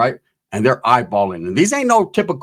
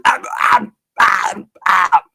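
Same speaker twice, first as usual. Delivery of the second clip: strained grunts; 3 semitones higher, much slower than usual. Retold or imitated. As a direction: mimic a tweaker's panicked ah sounds. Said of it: "Wood's going".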